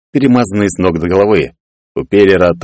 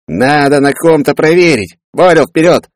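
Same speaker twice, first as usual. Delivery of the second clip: high, drawn-out scheming order; bossy command; 8 semitones higher, 3 dB louder than usual. A narrator's reading. Peller sends Boril to test the jump, commanding. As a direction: order your sidekick forward. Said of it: "скомандовал Пеллер".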